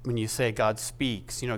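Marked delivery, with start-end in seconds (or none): none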